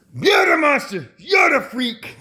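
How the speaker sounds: high shouted accusation, pointing the finger; mock accusing shout. Telling the world what the aliens shout at him, high mock shout. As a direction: yell it like a crowd calling him a freak.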